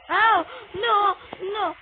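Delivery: loud, high cry of dismay; alarmed cry. Real, not acted. Player in sudden trouble, alarmed cry.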